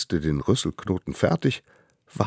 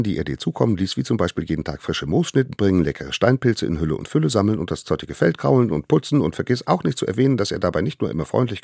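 fast breathless list; rattled off fast; 4 semitones higher, 4 dB louder, much faster than usual. Acted. Little hunter rattles off the endless name, fast. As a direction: race through it without a breath.

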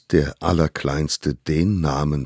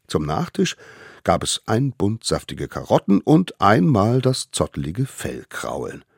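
ordinary delivery, nothing marked

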